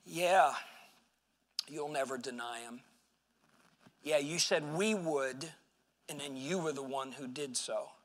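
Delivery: soft, low sneering jab; taunting a friend under the breath; mock taunt. Disciple sneers at Peter in the room, soft mocking.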